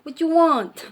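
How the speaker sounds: loud, high, fast and rude; high loud rude brush-off; rude retort. Retold or imitated. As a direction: snap back like a rude stranger. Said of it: "some people are just rude".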